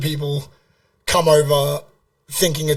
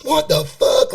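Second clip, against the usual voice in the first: much higher-pitched mock outburst; retold outraged yell; 9 semitones higher, 3 dB louder, slower than usual. American passenger joining the passport protest, imitated outraged cry. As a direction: re-enact a stranger's outrage, pitched right up. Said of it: "doing the same thing with me".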